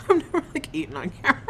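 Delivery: high giggly words through a laugh. Real, not acted. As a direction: laugh while apologizing for eating. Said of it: "sorry, it's probably gross".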